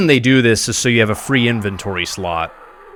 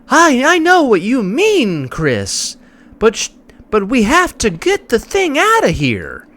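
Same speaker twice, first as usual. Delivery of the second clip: higher, slow, wooden parody of the heroine; mocking stiff game acting; 13 semitones higher than usual. Streamer parodies the game's stilted dialogue, higher wooden mock voice. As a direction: imitate the bad voice acting, overly sweet. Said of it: "Sometimes just the way they talk".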